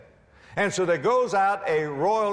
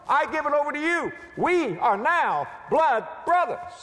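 raised, high preaching declaration; impassioned covenant pledge; 9 semitones higher than usual. Acted. Jonathan giving all he has to David, impassioned pledge. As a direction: build each phrase higher, driving the pledge home.

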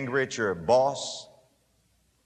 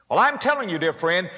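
ordinary delivery

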